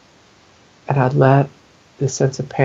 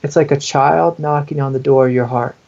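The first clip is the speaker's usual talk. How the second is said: normal talking voice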